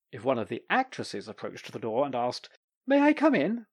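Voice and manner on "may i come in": high, light woman's voice; a lightly voiced actress politely asking. An actress asking at the dressing room door, high.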